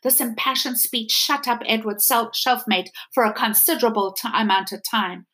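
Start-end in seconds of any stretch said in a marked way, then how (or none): none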